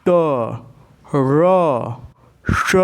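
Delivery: ट, र, श with the tongue tip curled back; demonstrating retroflex sounds. Shown as a demonstration. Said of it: "अपनी जिह्वा की नोक को मोड़िए". (मूर्धन्य उच्चारण)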